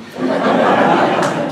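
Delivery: a loud burst of breathy laughter; real embarrassed laughter. Real, not acted. Bursts out laughing mid-speech.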